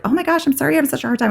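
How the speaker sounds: high-pitched, flustered apology; acted "so sorry" phone voice; apologetic phone lady. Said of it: "And she's like".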